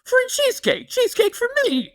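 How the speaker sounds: mock gleeful customer driving home; giddy sing-song. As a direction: croon it to yourself like a happy child. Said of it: "he's just like".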